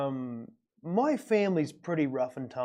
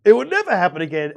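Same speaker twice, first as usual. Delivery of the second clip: emphatic; 2 semitones higher, 9 dB louder, faster than usual. Real, not acted. Emphatic point.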